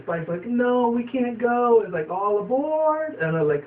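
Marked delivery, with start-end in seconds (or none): 0.5-1.8 high, slow cartoon pony voice; character voice. Retelling a cartoon pony's line, high and slow. 2.1-3.1 high, slow sing-song call; conductor call. Retold or imitated. Imitating the train call in a high, slow voice.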